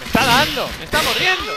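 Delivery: panicked high cries; being chased. Real, not acted. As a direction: yelp while being bitten. Attacked by dinosaur in game.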